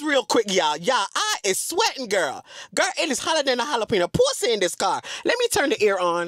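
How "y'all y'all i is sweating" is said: ordinary talk, nothing marked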